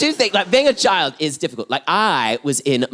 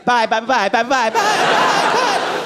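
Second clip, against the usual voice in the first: repeated byes climbing higher and higher; flustered goodbyes; 8 semitones higher than usual. Retold or imitated. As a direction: babble goodbyes, desperate to escape, acted. Acted embarrassed, hurried exit.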